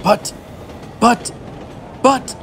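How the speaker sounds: high frightened stammer; panicked shout. A narrator's reading. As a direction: sputter out the fear of an old man. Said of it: "shouted Grandpa Joe".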